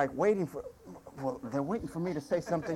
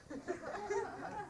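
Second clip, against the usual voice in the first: high-pitched giggle; a burst of giggling; high laugh; 12 dB quieter than usual. Real, not acted. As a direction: laugh at the punchline. Laughs at own punchline.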